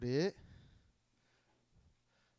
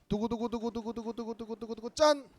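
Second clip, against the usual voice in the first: drumroll; 6 semitones higher, 3 dB louder than usual. Acted. Third drumroll.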